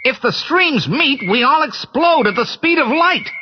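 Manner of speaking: alarmed Spengler. Acted. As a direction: lay out the danger, pitched high. Spengler explains the danger.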